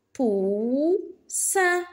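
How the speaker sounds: "Poussin" split into pou, ssin; syllable by syllable. Shown as a demonstration. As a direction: hold "pou", pause, then "ssin" in "Poussin". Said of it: "Frappe les syllabes". (« Poussin » en deux syllabes bien séparées)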